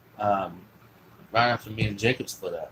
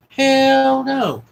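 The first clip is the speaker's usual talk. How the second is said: much higher and louder "hell no"; emphatic exclamation; 12 dB louder than usual. Real, not acted. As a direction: blurt it out loud in disbelief. Emphatic denial in story.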